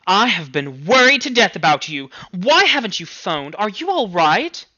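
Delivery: mother worried sick about her daughter. Acted. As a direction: fret over the phone like a scared mother. Worried mother scolding on phone.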